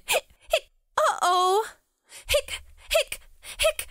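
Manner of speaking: very high squeaky hiccups. Acted. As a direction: hiccup with dismay. Character now has the hiccups.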